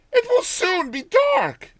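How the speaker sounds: put-on high, loud old village woman; old countrywoman. Old woman talking to herself.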